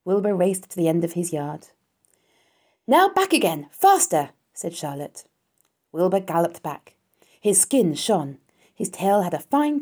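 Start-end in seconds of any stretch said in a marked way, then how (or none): 2.9-4.3 loud, high coaching shout; urgent command. A narrator's reading. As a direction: drive the runner on loudly. Charlotte drilling Wilbur with commands.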